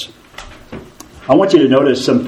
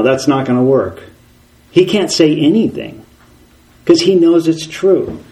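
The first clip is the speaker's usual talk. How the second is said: ordinary talk, nothing marked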